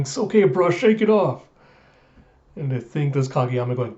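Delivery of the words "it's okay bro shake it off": high, loud yell of encouragement; encouraging scream. Retold or imitated. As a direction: shout it across the court to cheer up a teammate. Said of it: "in the background screaming".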